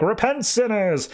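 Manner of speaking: high-pitched hammed-up warning to sinners; mock doomsday preacher. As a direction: imitate a preacher proclaiming damnation. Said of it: "he would basically just pull people in and tell them".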